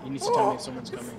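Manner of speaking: high, fast exasperated yelling; frantic scolding. Real, not acted. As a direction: rush it out in frustration. Frantic complaint to teammate.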